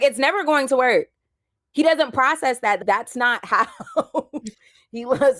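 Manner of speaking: high, loud, emphatic retort; laughing through a loud, high no-nonsense verdict; friend's blunt verdict. Retold or imitated. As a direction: belt it out like a friend who's seen it all. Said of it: "And she was like".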